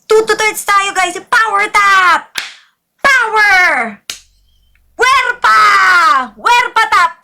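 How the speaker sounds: high, punchy hype calls; rallying shout. Real, not acted. Streamer rallying viewers to power tap, rallying shout. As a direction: call it out high, stretching the words.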